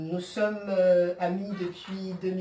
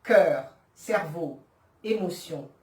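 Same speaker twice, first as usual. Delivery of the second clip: measured French verse with falling line ends; solemnly paced poetry reading; poem recitation; 3 semitones higher, 6 dB louder than usual. Acted. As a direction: recite it deliberately, pausing after each phrase.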